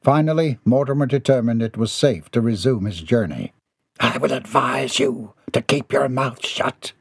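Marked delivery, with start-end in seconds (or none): 4.0-6.9 slow, restrained hiss; hissed warning. A narrator's reading. Captain silences the heap beside him, slow hiss. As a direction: force it out slowly, held back. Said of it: "he hissed at the heap".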